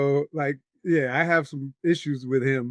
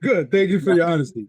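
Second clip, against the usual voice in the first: louder, higher, dry mock gravity; mock-serious thanks; 4 semitones higher, 7 dB louder, faster than usual. To the host who lost the lead, mock-serious louder thanks. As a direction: hand him a fake stern thank-you. Mock-grave reply after confession.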